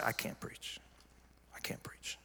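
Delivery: hushed, spent whisper; barely voiced admission of defeat. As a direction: whisper it as if you have nothing left. Defeated call to pastor friend, retold.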